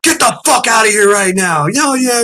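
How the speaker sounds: high-pitched retold order to leave; imitated outraged yell; angry shout. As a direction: bellow it like an irate trooper. Trooper throws him out.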